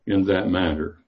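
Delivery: ordinary talk, nothing marked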